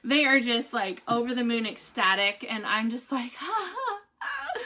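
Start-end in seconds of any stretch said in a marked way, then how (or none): 3.4-4.6 high, soft laugh breaking into tears; tearful happy laughter; laugh-crying. Real, not acted.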